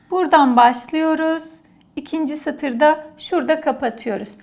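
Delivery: ordinary talk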